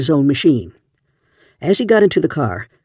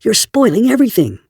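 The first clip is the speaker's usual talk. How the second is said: furious; 8 semitones higher than usual. A narrator's reading. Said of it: "said furiously".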